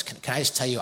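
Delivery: ordinary talk, nothing marked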